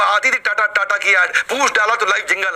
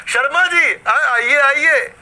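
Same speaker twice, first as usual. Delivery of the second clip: "शर्मा जी, आइए आइए" called out higher and louder, stretched slow and fond; affectionate welcome; 8 semitones higher, 4 dB louder, much slower than usual. Shown as a demonstration. Shopkeeper fondly welcoming passing neighbour Sharma ji, drawn-out call. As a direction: beckon a neighbour in lovingly. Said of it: "आपने उन्हें प्यार से बोला".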